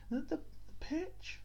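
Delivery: "pitch" said in a sudden high squeak; high pitch demo. Shown as a demonstration. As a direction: pitch jumps way up on "pitch". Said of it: "the speed at which you speak".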